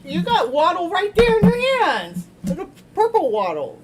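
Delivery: put-on high, loud sing-song baby voice; teasing cutesy voice; cutesy baby talk. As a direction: coo at the stuffed toy like a toddler. Teasing about the toy penguin.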